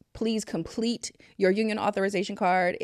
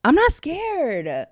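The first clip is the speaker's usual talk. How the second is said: high, loud, drawn-out declaration; raised voice, stretched words; loud defiance; 10 semitones higher, 7 dB louder, much slower than usual. Real, not acted. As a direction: call it out slowly and defiantly. Defiant repeat, escalating.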